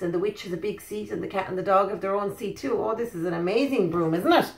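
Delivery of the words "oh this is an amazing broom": ordinary delivery, nothing marked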